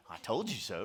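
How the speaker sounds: smug sing-song gloat of "I told you so"; snarky voice. Shown as a demonstration. Preacher showing the congregation their own snarky gloat. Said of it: "saying it in your snarky voice".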